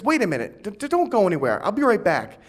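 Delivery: put-on high, loud pleading little kid; whiny kid voice. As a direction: beg like a persistent eleven-year-old. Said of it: "he goes".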